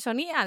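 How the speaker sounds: "Sonia" as so-NÍ-a, the i pushed up high; stress on the í. Shown as a demonstration. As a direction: stretch and lift the i in "Sonia". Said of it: "el acento en la I no pega".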